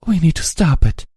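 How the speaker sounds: high, firm resolve; determined. A narrator's reading. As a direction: steel in the voice. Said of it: "finding determined steel".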